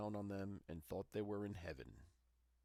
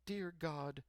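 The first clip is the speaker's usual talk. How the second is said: tearful prayer; 6 semitones higher, slower than usual. A narrator's reading. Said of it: "cried she".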